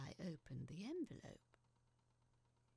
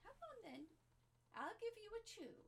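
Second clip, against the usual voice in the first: much higher, sing-song voice to a dog; coaxing pet talk; 7 semitones higher, 4 dB quieter than usual. Real, not acted. Owner coaxing her dog Dougal into the living room, high sweet pet voice. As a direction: coax the dog sweetly with a treat.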